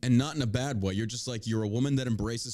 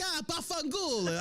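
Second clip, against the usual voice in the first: high, drawn-out mock Italian outburst; 14 semitones higher, 4 dB quieter than usual. Host teasing a Greek guest as Italian, mock Italian. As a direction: stretch it like a caricatured Italian. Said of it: "You come off as Italian".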